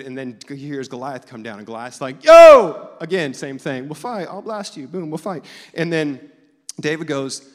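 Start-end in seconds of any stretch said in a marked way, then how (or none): 2.2-2.9 huge booming taunting yell; Goliath yelling. Retold or imitated. Giant taunting the Israelite army, roaring. 3.9-5.5 high cocky taunt; boastful giant. Retold or imitated. Goliath taunting the army, cocky.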